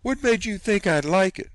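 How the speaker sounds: high, loud challenge; affronted aunt grilling the boy; indignant demand. Acted. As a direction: demand an answer.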